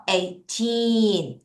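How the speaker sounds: put-on Eighteen with a long, strong TEEN; eigh-TEEEN, ending held; stress on TEEN. Shown as a demonstration. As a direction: stretch the second syllable of Eighteen. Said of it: "틴을 강하고 길게".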